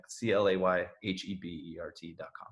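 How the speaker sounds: usual speaking voice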